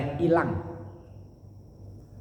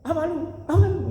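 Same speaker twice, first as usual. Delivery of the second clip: mock angry yelling; 13 semitones higher than usual. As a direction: act out someone angry and yelling. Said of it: "orang marah kan teriak-teriak ya".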